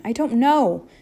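loud, desperate shout. A narrator's reading. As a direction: throw it back at him, loud. The shout silenced both of them.

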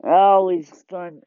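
normal talking voice

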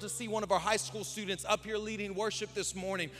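loud, high preaching build; fiery preaching. Acted. Pastor preaching hard to the church, loud and high. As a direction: preach it out to the congregation.

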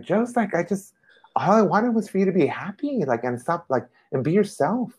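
high, earnest imitation of the caring friend; imitating the friend. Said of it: "she was just like".

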